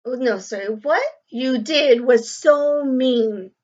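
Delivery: clear, lively storybook reading to a class; reading to children. A narrator's reading. Teacher reading a picture book to the class, lively and clear. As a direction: read the story aloud to young pupils.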